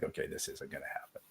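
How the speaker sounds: whispered inner thought; a resigned aside under the breath. Retold or imitated. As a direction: mutter it to yourself, almost voiceless. Inner thought when someone gets defensive.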